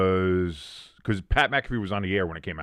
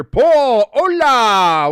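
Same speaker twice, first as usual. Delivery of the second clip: big, loud, high hail; real booming welcome; exuberant greeting; 13 semitones higher, 12 dB louder, much slower than usual. Real, not acted. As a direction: holler hello to a friend, drawn out. Calling out greeting to a viewer.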